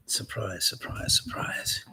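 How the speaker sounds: dry, hushed sarcastic surprise; sarcastic whisper. Real, not acted.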